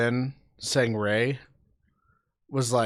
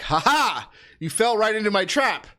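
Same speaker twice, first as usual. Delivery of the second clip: gloating villain; 8 semitones higher, 3 dB louder than usual. Retold or imitated. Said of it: "And then he's like".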